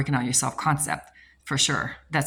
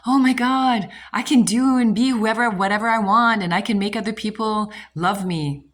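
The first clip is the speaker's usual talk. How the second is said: higher and louder, bursting with eager hope; excited gushing; 6 semitones higher, 4 dB louder than usual. Retold or imitated. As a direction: gush like you just found out you can have anything. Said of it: "a lot of people think".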